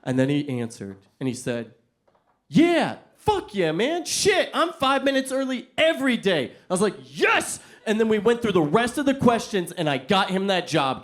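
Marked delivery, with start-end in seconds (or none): 2.5-6.5 bro enthusiasm. Retold or imitated. Client boasts enthusiastically. 7.0-7.6 high victorious cheer; triumphant yes. Retold or imitated. Interviewer celebrating the client's answer, triumphant.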